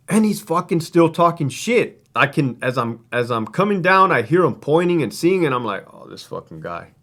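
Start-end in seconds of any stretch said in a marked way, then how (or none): 5.9-6.9 low, very soft grumble to himself; retold exasperated aside. Officer to himself about a taunting inmate, quietly exasperated. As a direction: imitate a fed-up remark kept under your breath.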